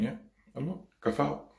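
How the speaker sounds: high, soft imitation of mum; imitating mum. Mum watches over her child riding, imitated high soft voice. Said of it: "there was Mum standing really beautiful".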